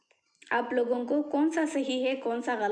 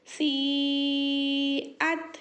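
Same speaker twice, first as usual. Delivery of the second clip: long held vowels, very slow; slow chanted spelling; drawn-out recitation; much slower than usual. Shown as a demonstration. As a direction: stretch each sound like Quran reading. Teacher drawing out vowels.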